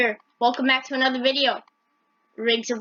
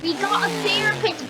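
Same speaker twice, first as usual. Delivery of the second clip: higher, whiny disbelief; exasperated Tommy; 5 semitones higher than usual. Retold or imitated. Tommy griping at his teammate over the backflip, high and exasperated. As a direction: complain like a fed-up teammate. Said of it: "Mister Renty Renty's like".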